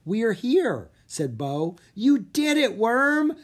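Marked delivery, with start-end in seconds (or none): 0.1-0.9 higher, brighter voice, thrilled to arrive; excited arrival. Acted. Arrival in the kingdom. 1.9-3.4 a high, loud cheer for his dragon. Acted. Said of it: "Drake cheered".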